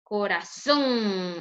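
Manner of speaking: "Corazón" slowly with a long ZÓN; final-syllable stress. Shown as a demonstration. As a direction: lean hard and long on the end of "Corazón". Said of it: "la mayor fuerza de voz".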